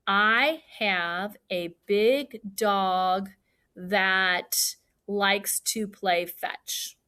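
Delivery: very slow, word by word; slow modelling. Shown as a demonstration. Teacher models a longer sentence for fourth graders, slowly, word by word. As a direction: space out each word for learners to count.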